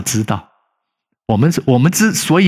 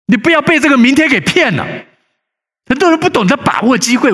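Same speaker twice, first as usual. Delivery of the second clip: raised, louder preaching voice; fervent preaching; 14 semitones higher, 3 dB louder than usual. Acted. Pastor warns the congregation against delay, preaching higher and louder. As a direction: drive the warning home from the pulpit.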